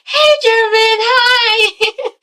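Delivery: high-pitched, singsong greeting; hammed-up chirpy greeting; extra perky. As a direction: overdo a perky hello. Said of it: "She's extra perky, okay?".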